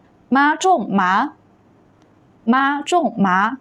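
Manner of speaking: slow, carefully toned Mandarin demonstration; teacher modelling tones slowly; model reading. Shown as a demonstration. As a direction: read each syllable apart for learners to repeat. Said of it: "I'll read it first and you can repeat".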